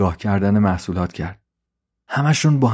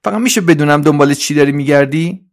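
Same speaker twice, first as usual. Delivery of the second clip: high, raised inquisitive voice; Trolley; 5 semitones higher, 5 dB louder than usual. Acted. Trolley asks what Suzi seeks, high nosy voice. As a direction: ask high and a bit louder. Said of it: "دوباره ترولی گفت".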